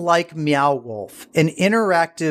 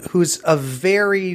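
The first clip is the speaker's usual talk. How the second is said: normal talking voice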